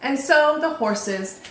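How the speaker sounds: measured, melodic storybook narration; children's story reading. A narrator's reading. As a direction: tell the tale to little ones, phrase by phrase.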